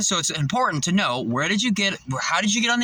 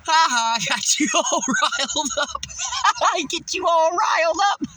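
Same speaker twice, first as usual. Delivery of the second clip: high, laughing sing-song taunt; mocking taunt; 10 semitones higher than usual. To the manager, taunting her for getting riled up, a high laughing mock. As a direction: tease her, cackling as you go.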